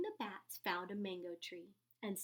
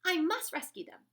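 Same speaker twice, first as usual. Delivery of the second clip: determined; 8 semitones higher, 10 dB louder than usual. A narrator's reading. Determined rescue.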